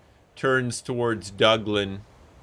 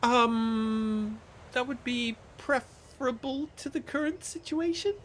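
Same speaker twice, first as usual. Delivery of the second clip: higher, softer ladylike voice; lady's voice; 12 semitones higher, 4 dB quieter than usual. Acted. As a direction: speak as a genteel lady. Diza voiced by game master.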